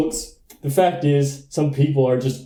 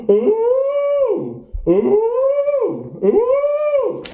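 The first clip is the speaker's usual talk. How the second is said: seal bark impression; much slower than usual. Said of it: "bark with the traditional crane neck".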